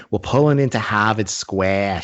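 put-on train announcer in broad Boston accent; mock Boston accent. Train driver announcing the stop, mock Boston accent. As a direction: imitate a Boston conductor, dropping the R's. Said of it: "the announcement going".

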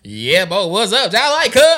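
mock the local DMV way of talking; a put-on DMV accent, rising at the end. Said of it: "They talk like".

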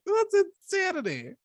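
very high, squeaky disbelief; mock-appalled high squeal; incredulous. Incredulous reply to blackmail story.

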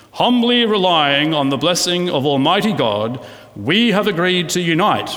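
grand, solemn official proclamation; constitutional voice. Shown as a demonstration. Preacher mock-reads the Constitution in a grand official voice. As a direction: read it like a stately founding document. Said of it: "I should say it in a sort of constitutional voice".

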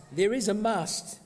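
must leaned on, slowly; stress on must. Shown as a demonstration. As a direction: weigh must, drawn out. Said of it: "The stress is on the must".

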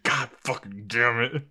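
seething under the breath, half-played; mad. Imagining his reaction to burying his lighter, mad. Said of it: "I'd just be mad".

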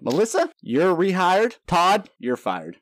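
drawn-out pretend boss verdict; mock boss. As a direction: hand down a joke firing with ceremony. Plays boss rehiring and firing.

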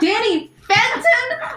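high, loud, drawn-out squeal; excited squeal. Real, not acted. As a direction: gush over the name slowly. Excited recognition of cartoon crush.

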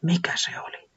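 startled, whispered question; breathless scared whisper; frightened whisper. Acted. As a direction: hush it out in sudden fear. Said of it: "Villavallaton pelästyi".

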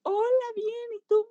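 saludo mucho más agudo, lleno de alegría; respuesta emocionada. Retold or imitated. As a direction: contesta al niño con alegría muy aguda. Responde emocionada al niño. (la terapeuta contesta al niño con alegría aguda)

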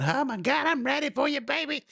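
high, breathy-free mock sultry lady voice; mock flirty woman. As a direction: camp it up like a contestant purring at the guy. Contestant's flirty limo intro.

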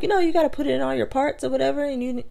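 put-on high, bright-eyed retelling of the girl; lightly voiced girl explaining her soap; high girly mock voice. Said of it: "She was like".

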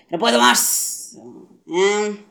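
loud slow "no puedo más"; fed-up outburst. Real, not acted.

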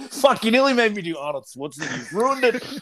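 high, cracking up out of character; laughing protest. Real, not acted. Performer blaming co-host for ruining the bit, high laughing protest. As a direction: blurt the complaint through laughter, pitch climbing.

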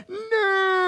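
high, drawn-out "no" of horror; comic wail as if snatching words back; mock regretful cry. Wanting to pull angry words back, mock horrified wail. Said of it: "as you're saying it, you're like".

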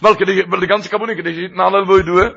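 fast, high, loud sermon drive; preaching. Acted. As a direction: press the teaching home with fire.

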